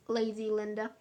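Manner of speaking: usual speaking voice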